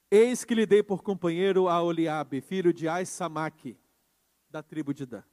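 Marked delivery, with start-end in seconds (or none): none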